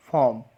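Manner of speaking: फॉर्म as "fawm", no r; silent R. Shown as a demonstration. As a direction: drop the r in फॉर्म, long vowel. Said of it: "तो आर क्या हो जाएगा? साइलेंट". (फॉर्म में आर silent, "फॉम")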